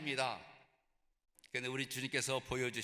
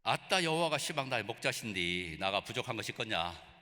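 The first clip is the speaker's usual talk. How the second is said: Psalm line in thick Jeolla dialect, a bit louder; 3 semitones lower, 3 dB louder than usual. Shown as a demonstration. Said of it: "전라도 버전을".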